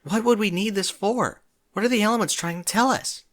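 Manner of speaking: a pony in a panic trot; panicked. A narrator's reading. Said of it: "her mind racing".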